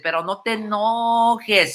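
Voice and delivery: drawn-out mock begging on enojes; mock pleading. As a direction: stretch it out like a teasing whine. Teasing repeat of the same plea.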